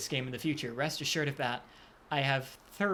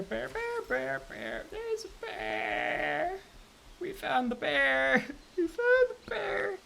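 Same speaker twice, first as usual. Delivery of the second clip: sing-song; 10 semitones higher, slower than usual. Real, not acted. High slow sing-song after escape.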